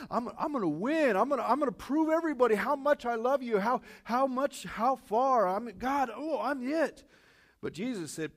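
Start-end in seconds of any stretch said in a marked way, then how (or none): 0.1-7.0 loud, high, overzealous disciple; eager boasting. Acted. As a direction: pledge loyalty at full volume, voice pitched up. Acting out Peter's eager vow to Jesus.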